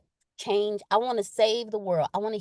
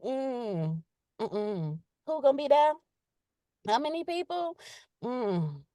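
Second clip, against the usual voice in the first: reluctant, wary humming and questioning; hesitant; 4 dB quieter, slower than usual. Acted. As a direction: hold back with doubtful little hums, then ask warily. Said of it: "I'ma be hesitant".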